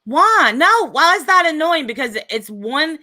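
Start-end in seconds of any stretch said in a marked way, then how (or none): 0.0-1.9 loud sharp voice. Real, not acted. Heated argument, raised voice.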